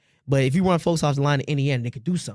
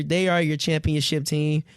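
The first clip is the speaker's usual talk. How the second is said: usual speaking voice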